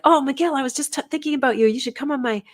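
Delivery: high, fast, gushing delight; excited greeting. Retold or imitated. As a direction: gush at him like an old friend. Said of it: "And I'm like".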